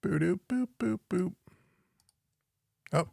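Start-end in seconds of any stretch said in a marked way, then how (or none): 0.0-1.3 playful beep noises. Real, not acted. Humming beeps while clicking tracks.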